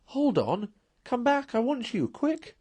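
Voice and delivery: high, eager calls after a friend; urgent excited calling. Acted. Mole calling Rat back, high and urgent. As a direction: call him back, bursting with excitement. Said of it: "he called".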